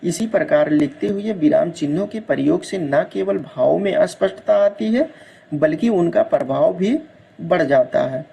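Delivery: normal talking voice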